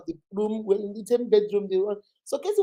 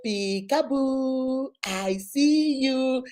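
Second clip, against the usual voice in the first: slow sing-song toy voice, a bit higher; 3 semitones higher, much slower than usual. Retold or imitated. Mother mimicking the daughter's toy, slow sing-song. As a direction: imitate the talking baby toy. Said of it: "it will say".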